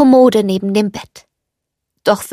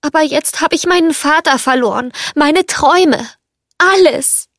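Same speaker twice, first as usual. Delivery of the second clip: higher-pitched, sorrowful complaint; distressed lament; 6 semitones higher than usual. A narrator's reading. Belle tells the teapot of her lost father, distressed. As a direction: let the loss lift the voice higher toward the end.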